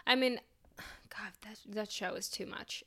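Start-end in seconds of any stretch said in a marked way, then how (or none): 1.1-2.8 whispered. Real, not acted. Overwhelmed by the show.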